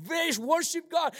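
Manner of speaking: high-pitched mock worship cry; imitated worship singer. Preacher mimicking the second song, high worship cry. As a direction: mimic a congregation praising, high. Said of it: "The second song".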